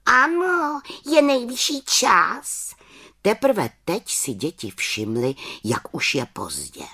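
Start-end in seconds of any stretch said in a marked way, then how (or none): 0.1-2.8 high, slow witch insisting; little witch voice. Acted. Little witch insists it is late, high voice.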